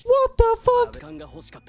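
high-pitched, drawn-out shock; shocked outburst. Real, not acted. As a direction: cry out in real disbelief. Shocked reaction to the show.

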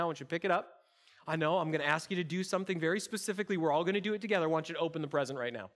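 ordinary talk

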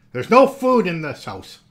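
stingy uncle loudly denies having food; crying out. Acted. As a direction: insist it loudly, drawn out. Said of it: "he cried".

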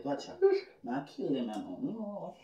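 ordinary talk, nothing marked

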